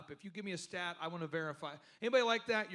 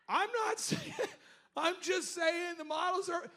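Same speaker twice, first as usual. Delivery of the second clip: high, laughing, shouted protest; flustered protest; 11 semitones higher than usual. Retold or imitated. Defending himself to mocking friends, high and shouting. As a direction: sputter a defense through a laugh.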